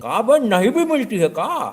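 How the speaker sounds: slow loud mock heckle; comic shout. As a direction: drag out the silly question loudly. Know-it-all grills shopkeeper.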